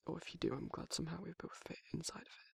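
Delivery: intimate whisper, close to the ear; soft ASMR whisper. Acted. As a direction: murmur tenderly to a sleepy listener. Whispered ASMR roleplay to listener.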